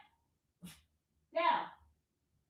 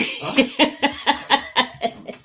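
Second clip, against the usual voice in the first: long, rippling laugh; laughter; 4 semitones lower than usual. Real, not acted. Owner amused by the dog looking around, laughing.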